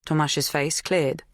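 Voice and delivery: ordinary delivery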